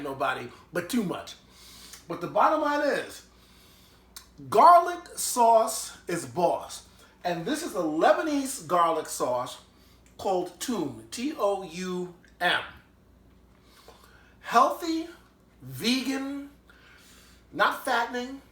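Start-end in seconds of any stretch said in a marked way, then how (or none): 4.5-6.8 slow, loud and higher, leaning on every word; emphatic praise. Real, not acted. Enthusiastic food verdict. 14.4-18.4 excited pitch. Real, not acted. Enthusiastic selling of the sauce.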